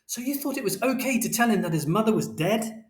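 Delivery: high-pitched, outraged accusation; angry shout. A narrator's reading. Parents rowing over the lie about death, high shout. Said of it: "And Father shouted".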